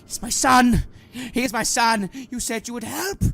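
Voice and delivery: high-pitched, desperate father's cry; anguished pleading. Acted. Father defending his son from the cleric, anguished plea. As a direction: wail the betrayal at full voice.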